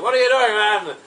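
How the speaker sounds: a jumped, outraged sheriff who has just been kissed; startled sheriff. Retold or imitated.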